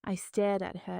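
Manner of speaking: usual speaking voice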